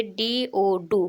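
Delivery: डी ओ डू spelled slowly letter by letter; slow spelling. Shown as a demonstration. Teacher showing beginners, slow spelling. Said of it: "डू की spelling देख लीजिए".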